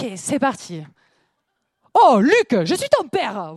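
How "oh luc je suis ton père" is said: a hint of southern accent on the famous line; loud opening, then plain southern sing-song; southern Darth Vader. Vader telling Luke the truth, lightly voiced southern accent. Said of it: "Star Wars".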